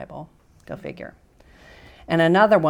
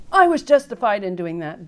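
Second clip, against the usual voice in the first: raised, loud defensive voice; self-justifying; 6 semitones higher, 8 dB louder than usual. Retold or imitated. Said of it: "We jump to that".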